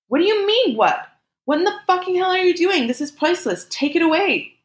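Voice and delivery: very high, distraught yelling; high frantic protest; panicked outrage. A narrator's reading. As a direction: plead and scold in a panic, pitch soaring. Panicked woman yells at lover.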